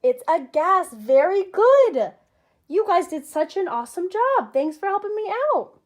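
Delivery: high, loud, beaming praise; enthusiastic praise. Acted. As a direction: cheer like a kids show host. Praising pretend answers from kids.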